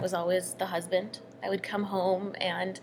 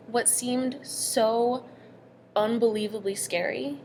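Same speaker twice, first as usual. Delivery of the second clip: ordinary talk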